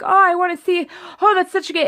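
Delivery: gushing excitement. Retold or imitated. Wife gushing to husband about her favourite movie, excited and high. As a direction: squeal it like a fan who can't wait.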